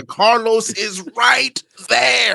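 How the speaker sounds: exasperated slow shout. Real, not acted. Exasperated about the name Chad.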